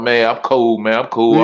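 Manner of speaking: low-pitched take on a recovering addict; mock addict in counseling. Roaster mimicking a man at counseling, low mock reassurance. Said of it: "He talking about".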